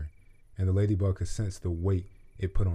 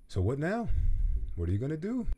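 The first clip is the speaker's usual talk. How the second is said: higher, light curious question; curious ladybug; 8 semitones higher than usual. A narrator's reading. Ladybug asking the butterfly's plans, higher. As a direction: ask it with gentle interest.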